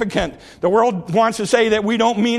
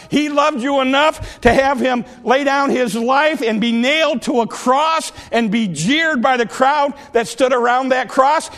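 ordinary talk

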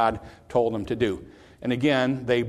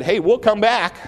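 higher, louder breezy promise; confident assurance; 8 semitones higher, 7 dB louder than usual. Retold or imitated. Abraham promising his servants he will return, confidently called out. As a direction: call it out with easy confidence.

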